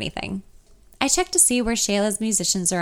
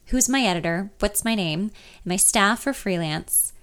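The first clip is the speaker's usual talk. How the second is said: plain reading voice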